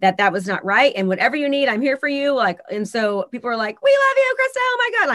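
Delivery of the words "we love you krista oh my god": squealing high, adoring fan voice; high-pitched, breathless adoration; gushing fans. Retold or imitated. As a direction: mimic the gushing commenters.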